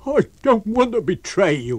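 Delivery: high, loud frightened yelp; terrified tramp. A narrator's reading. Tramp to his unseen master after a tap, terrified. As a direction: plead in a high panicked tramp's voice. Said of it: "gave a yelp of terror at the touch".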